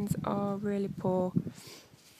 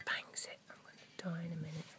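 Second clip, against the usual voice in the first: soft, fast unvoiced whisper to herself; hushed whisper; 4 semitones lower, 14 dB quieter, faster than usual. Real, not acted. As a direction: mutter it under your breath. Muttering while digging.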